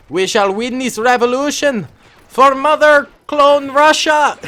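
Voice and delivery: high, loud mock-Russian rallying cry; badly faked Russian accent, slow and booming at the end. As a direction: proclaim it like a hammed-up Russian revolutionary. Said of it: "I cannot do a Russian accent".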